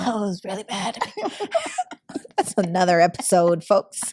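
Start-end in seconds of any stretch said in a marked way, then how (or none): 1.0-2.3 shrieking, high-pitched laugh; high squeal of laughter. Real, not acted. Laughing about crazy past.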